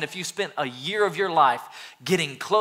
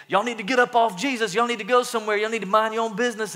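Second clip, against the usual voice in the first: higher, brash shooing voice; mock disciples; 6 semitones higher, faster than usual. Disciples shooing the kids away, in a higher brash voice. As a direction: shoo them off, higher and bossy. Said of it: "the disciples were saying".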